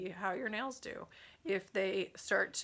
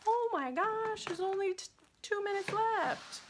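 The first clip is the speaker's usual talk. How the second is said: high-pitched surprise; 13 semitones higher than usual. Real, not acted. Host noticing the livestream is nearly over, squealing surprise. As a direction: let the voice leap way up in alarm at the clock.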